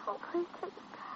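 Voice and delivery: breathless whispered begging; barely a breath, pleading; whispered plea. Acted. Girl begging the doctor, whispered.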